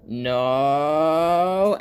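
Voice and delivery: long, high, loud protest; cartoon wail. Flower friend sent down the mountain, long loud wail. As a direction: stretch one word into a rising wail.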